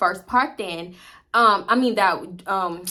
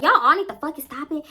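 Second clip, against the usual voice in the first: yelling; 6 semitones higher, 4 dB louder than usual. Retold or imitated. Petty yelling to stop.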